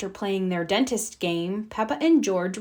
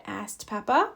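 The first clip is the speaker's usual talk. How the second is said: plain reading voice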